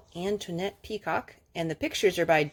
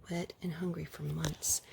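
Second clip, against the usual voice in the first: whispered narration; 4 semitones lower, 14 dB quieter than usual. Acted.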